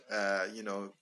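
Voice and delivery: usual speaking voice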